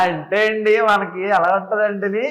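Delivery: high-pitched comic character voice; acted comedy. Guest performs funny rasa on request, high silly comic delivery. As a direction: ham it up for laughs, pitched well up. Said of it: "చాలా ఫన్నీగా హాస్యం".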